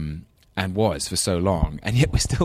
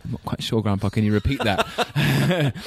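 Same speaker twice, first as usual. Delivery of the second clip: lightly played-up London English, higher pitched; higher, politely baffled London English; English London accent; 6 semitones higher than usual. London grandson asks his Scottish grandpa to repeat, English London accent. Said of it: "with my very English London accent".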